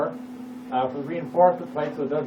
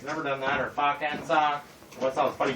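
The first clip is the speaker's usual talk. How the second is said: normal talking voice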